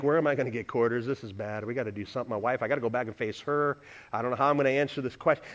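low, soft, hurried fretting; worried inner thoughts. Retold or imitated. Preacher fretting over quarters and facing his wife, worried. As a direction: think it under the voice, running on. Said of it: "in the back of my mind".

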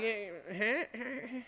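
soft, cowed little stammer; meek stammer. Retold or imitated. Honking driver cowed by the angry man, meek stammer. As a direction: squeak it out timidly. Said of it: "And he's like".

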